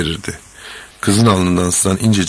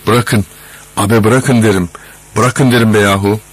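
raised, louder angry protest; shouting; 3 semitones higher, 5 dB louder than usual. A narrator's reading. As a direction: shout to be let go, with narrator's restraint. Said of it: "bas bas bağırıyordu". (öfkeyle sesini yükselterek)

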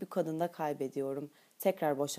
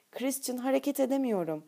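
higher-pitched, mild mock objection; playful protest; 6 semitones higher than usual. A narrator's reading. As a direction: protest lightly, not in earnest. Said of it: "diye itiraz ediyor ama bir yandan da gülüyor".

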